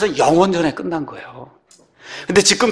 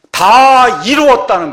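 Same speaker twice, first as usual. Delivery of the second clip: slow, loud, higher-pitched proclamation; loud cry; 7 semitones higher, 9 dB louder, slower than usual. Shown as a demonstration. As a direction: cry it out loudly, stretching the words. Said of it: "제가 큰 소리로 외친 거예요". (큰 소리로 길게 외치기)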